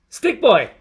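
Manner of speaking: loud taunting call. Acted. Someone taunting Stick Boy, jeering shout. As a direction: call him out across the street.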